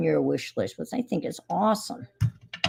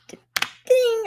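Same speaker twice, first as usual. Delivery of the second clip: idea ding. Pretend. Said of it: "idea ding".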